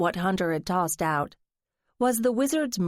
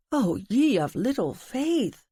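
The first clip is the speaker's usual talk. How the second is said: mock offended; 4 semitones higher than usual.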